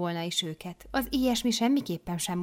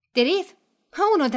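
sudden higher, louder snap; mother superior dismissing; 10 semitones higher, 8 dB louder than usual. Acted. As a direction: cut Teréz off sharply. Abrupt dismissal.

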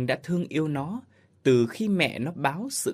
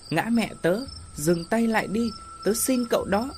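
put-on pleading child; 9 semitones higher than usual. Child begs ghost to stop.

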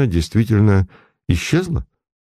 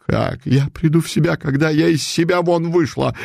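higher wail, hammed up; 7 semitones higher than usual. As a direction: wail at him, beside herself. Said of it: "ещё больше завопила купчиха". (вопль купчихи, выше)

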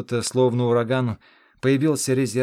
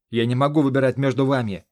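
loud, raised, anguished bark; barked outburst; 3 semitones higher, 7 dB louder than usual. A narrator's reading. Hero refusing to choose between two wounded friends, barked outburst. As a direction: bark it out in protest. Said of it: "рявкнул я".

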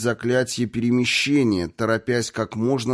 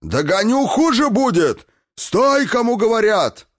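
very high, loud threatening shout; inhuman bellow; 13 semitones higher, 6 dB louder than usual. A narrator's reading. Destroyer chasing the fleeing snake king, furious inhuman bellow. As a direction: roar threats after a runaway. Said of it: "нечеловеческим голосом гаркнул губитель".

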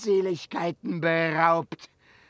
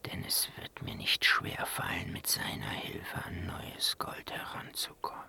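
low, softer conspiratorial whisper; scheming whisper aside; 10 semitones lower, 9 dB quieter than usual. Acted. Captive dwarf whispering his scheme aside. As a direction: plot to himself under the breath.

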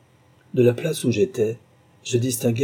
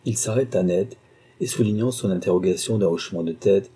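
ordinary delivery

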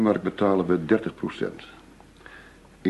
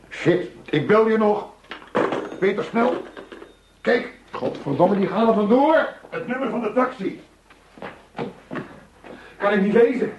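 urgent alarmed shouting. Acted. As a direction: yell in alarm as they get away. Suspects escape, agent panics. (paniekerig geroepen, veel hoger)

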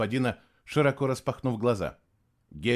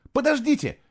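very high, pleading call; shout; 14 semitones higher, 4 dB louder than usual. A narrator's reading. Boy calls to the others to wait, shouted high. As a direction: call after people running ahead. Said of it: "крикнул Фло".

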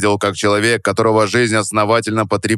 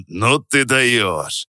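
laughing; much slower than usual. Acted. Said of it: "рассмеялся Петров".

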